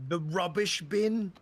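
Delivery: put-on British accent. Naming the British trash can, mock British. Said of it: "they don't call the trash can".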